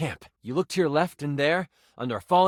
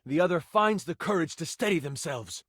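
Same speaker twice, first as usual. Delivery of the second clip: ordinary talk, nothing marked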